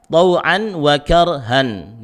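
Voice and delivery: "karhan" slow with a thick, heavy ra. Shown as a demonstration. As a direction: draw out "wa karhan" full and heavy.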